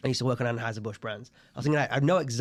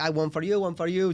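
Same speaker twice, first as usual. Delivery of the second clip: put-on Italian accent; 5 semitones higher, 3 dB louder than usual. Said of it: "He's like".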